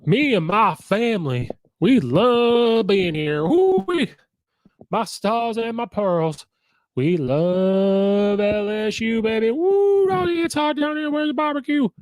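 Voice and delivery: hammed-up high, loud Southern drawl; fake Southern accent. Mocking a coach addressing his new crowd, exaggerated Southern accent. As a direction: whoop it up in an exaggerated Southern twang, stretching words. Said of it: "He develops a Southern accent".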